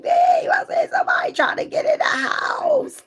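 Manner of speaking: very high, loud panicked scream. Retold or imitated. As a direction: shriek for help like a burglar's inside. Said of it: "and I was like".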